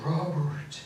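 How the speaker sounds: imitating dad calling sharply up high; calling out a name. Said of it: "I heard this voice go".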